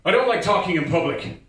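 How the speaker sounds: mock exasperation. Telling the pushy friend to stop, exasperated. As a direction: draw the line, imitating a fed-up self. Retold snapping at nagging friend.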